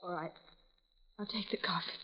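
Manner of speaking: beaten-down whisper; defeated whisper. Acted. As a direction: barely breathe the words out. Worn-down victim gives in.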